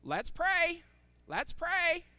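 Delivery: high, loud parrot voice; boy parrot. Acted. Shop owner imitating the boy parrot, high parrot voice. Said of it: "this boy parrot that I have here".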